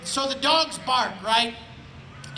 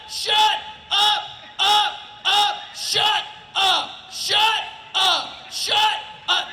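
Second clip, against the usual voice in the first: higher, clipped repeated shouts of a fed-up neighbour; neighbour yelling; 9 semitones higher, slower than usual. Retold or imitated. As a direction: yell it over the fence, again and again. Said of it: "I know what he sounds like".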